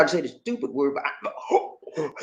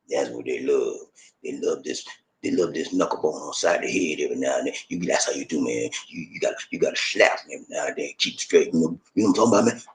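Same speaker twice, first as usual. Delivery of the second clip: put-on domineering good old boy; 7 dB quieter, faster than usual. Mocking a controlling husband's boasts to his woman, in a low mock tough voice. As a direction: play the bossy husband laying down the law, way down low. Mocking guys who think slapping is love.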